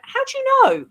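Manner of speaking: retold astonishment. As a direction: imitate her amazed reaction. Retold startled reaction to his find.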